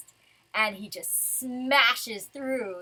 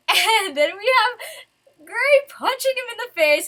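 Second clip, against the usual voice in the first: laughs through the words, delighted; laughing; 11 semitones higher, 10 dB louder than usual. Real, not acted. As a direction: talk while laughing with glee. Laughing while describing punch.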